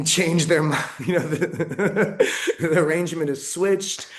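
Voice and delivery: words broken up by laughter; amused, chuckling as the story turns; laughing while talking. Real, not acted. Laughs while telling the switch.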